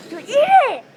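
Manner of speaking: loud high urging yell; excited shout. Real, not acted. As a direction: yell at him to eat. Urging friend to eat.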